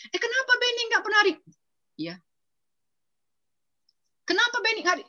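high, quick nosy question; curious gossip. Retold or imitated. Church members asking why Benny stopped coming, high and nosy.

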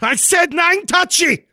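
high-pitched, drawn-out scolding; agitated outburst. Acted. Telling a friend not to touch his armor, agitated. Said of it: "Calm down".

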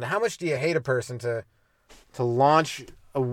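ordinary talk, nothing marked

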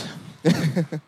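pleased little laugh at his own impression; short laugh. Real, not acted. Preacher laughs to the church after his dad impression, light laugh. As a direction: chuckle after nailing the voice.

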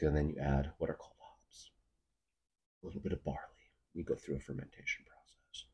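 hushed brother explaining. Retold or imitated. Older brother explains brewing.

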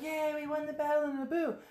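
high, soft little "yay"; half-hearted mock cheer. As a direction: imitate a tiny celebrating crowd. Voicing the celebrating heroes.

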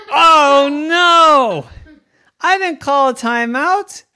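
high, loud wail; dismayed outcry. Acted. Coach realizes the ref misheard, dismayed outcry. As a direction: cry out in dismay and protest.

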